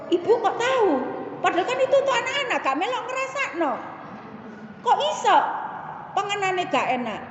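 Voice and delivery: high, quick mock incredulity; retold puzzled questioning. Speaker questions the other mother, high retold puzzlement. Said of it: "Saya bertanya gitu".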